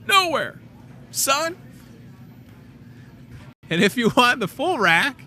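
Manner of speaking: cocky high-pitched gotcha; triumphant high exclaim. Real, not acted. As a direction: slam the point home with a grin. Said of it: "challenging price comparison".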